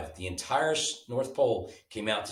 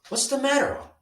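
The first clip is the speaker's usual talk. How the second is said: much higher, small elf voice; little elf voice; 5 dB louder than usual. A narrator's reading. Elf friend asking sad Dot what's wrong, high little voice. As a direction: ask like a tiny, caring elf. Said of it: "Oliver asked".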